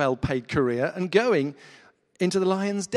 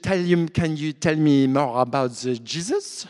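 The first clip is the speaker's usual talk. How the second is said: louder imitation of her French accent; a Frenchwoman asking in halting English; 5 dB louder than usual. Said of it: "but with this kind of accent".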